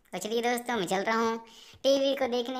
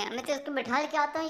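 ordinary talk, nothing marked

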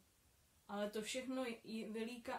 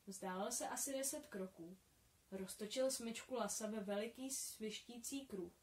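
ordinary delivery, nothing marked

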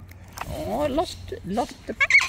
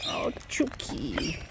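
slow soft whisper; 5 semitones lower, 6 dB quieter, much slower than usual. Real, not acted. Owner whispering to her pet bird. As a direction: breathe the name fondly.

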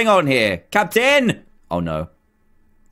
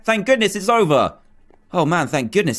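ordinary talk, nothing marked

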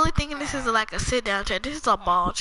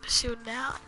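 hushed, barely-voiced murmur; quiet half-whispered gameplay muttering; talking low; 8 semitones higher, 7 dB quieter than usual. Real, not acted. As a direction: keep it soft, almost to yourself. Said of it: "I'm talking so low".

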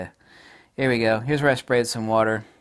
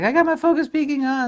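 much higher, mock-proud voice of a gear snob; smug camera owner; 5 dB louder than usual. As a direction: mimic a boastful buyer, hammed up. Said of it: "It's like".

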